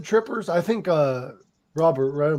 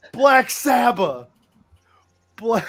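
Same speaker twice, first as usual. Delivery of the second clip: metal scream; 6 semitones higher, 4 dB louder, slower than usual. Shown as a demonstration. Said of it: "it's like you're screaming".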